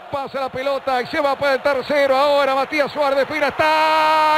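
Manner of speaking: breakneck goal commentary. Real, not acted. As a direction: build toward the goal. Live play-by-play.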